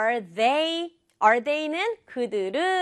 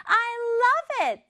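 high-pitched delighted exclamation; lively, happy; 13 semitones higher, much slower than usual. Acted. Daughter hearing her mother's idea, lively happy voice. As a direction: draw it out high and joyful. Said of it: "되게 활기차고 기분 좋은 목소리로".

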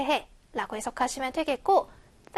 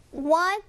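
"What" punched higher; stressed What; 4 semitones higher than usual. Shown as a demonstration. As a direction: hit "What" high with a rising tone. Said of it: "강세".

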